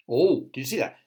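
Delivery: ordinary delivery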